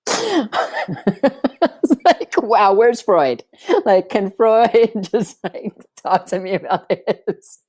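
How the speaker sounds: high, laughing disbelief, slowed; amused laughter. Real, not acted. Laughing at the absurd mom reaction.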